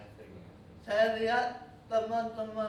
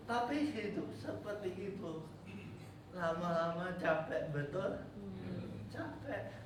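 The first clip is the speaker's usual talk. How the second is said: softer, tired, letting the fight go out; weary and quiet; 3 semitones lower, 8 dB quieter than usual. Real, not acted. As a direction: sigh out how exhausting it was. Confessing weariness of fighting. (lirih dan lelah, suara melemah)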